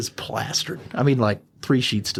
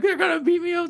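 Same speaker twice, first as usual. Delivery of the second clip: mock crying. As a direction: imitate a drunk man crying and begging. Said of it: "As he's, like, crying".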